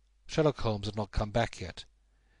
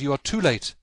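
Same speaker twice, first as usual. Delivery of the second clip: alarmed cry; 4 semitones higher, 6 dB louder than usual. Acted. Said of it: "I cried".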